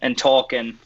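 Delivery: chatty Australian demo accent. Shown as a demonstration. Showing friends an Australian accent on request, relaxed Aussie voice. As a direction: talk like an Aussie from Queensland. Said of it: "hit us with your Australian accent".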